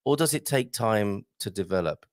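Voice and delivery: usual speaking voice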